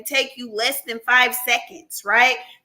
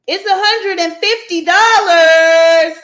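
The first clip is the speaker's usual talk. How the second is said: louder and slow, every word weighted; loud announcement; 5 semitones higher, 10 dB louder than usual. Real, not acted. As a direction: announce the prize proudly. Announcing prize amount.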